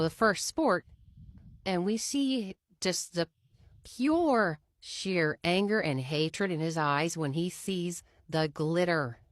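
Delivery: usual speaking voice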